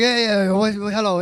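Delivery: loud, impatient brush-off hello; grumpy, loud yeah yeah what; irritated bark. Retold or imitated. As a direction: bark it like you're fed up. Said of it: "you're all mad".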